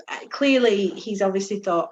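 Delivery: ordinary delivery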